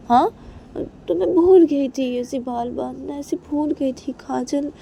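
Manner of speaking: high, protesting self-defence; whiny excuse. Acted. Reshma explains forgetting to Kajal, whiny and defensive.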